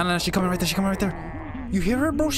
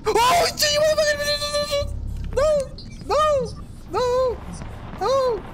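terrified screaming; slower than usual. Real, not acted. Caught by the monster.

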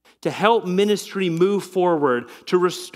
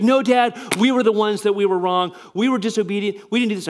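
put-on higher, eager kids' voices pleading; children protesting; 5 semitones higher than usual. Dad imitates his children insisting they were wrong, higher and eager. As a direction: pitch up like the kids interrupting.

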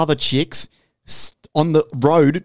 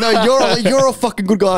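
hyped reply; 7 semitones higher than usual. Retold or imitated. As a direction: throw the compliment straight back. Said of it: "And I was like".

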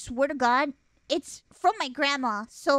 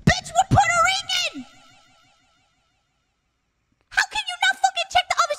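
very high, loud reenacted shouting; outraged yelling; 6 dB louder, faster than usual. Sibling to sister who preheated the oven, outraged yelling. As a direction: yell in shrieky, incredulous outrage.

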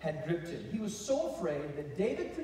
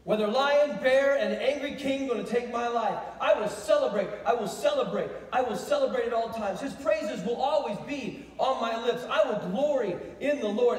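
loud, raised, exultant preaching; raving celebration; 4 semitones higher, 6 dB louder than usual. Acted. David vowing praise despite lion, bear and king, celebrating loudly. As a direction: declare it as jubilant, defiant praise. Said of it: "This word extol means to rave and to celebrate".

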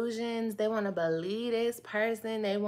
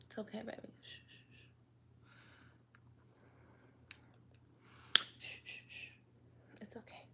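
much softer shushing whisper; hushing whisper; 18 dB quieter, faster than usual. Pretend. Hushing the pretend baby off camera, soft whisper. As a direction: soothe the baby so it stays asleep.

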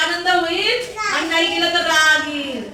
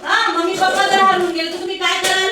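ordinary talk, nothing marked

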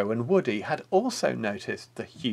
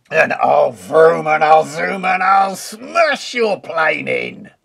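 loud furious wolf threat; angry cry; 4 semitones higher, 11 dB louder than usual. Acted. Furious wolf threatening the pigs, loud bellow. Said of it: "cried the wolf".

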